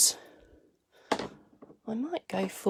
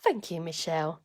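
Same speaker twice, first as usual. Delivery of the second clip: ordinary talk, nothing marked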